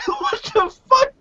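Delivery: can't believe what he just heard; incredulous outburst. Real, not acted. Reacting to Ryback hating the couple, high incredulous outcry.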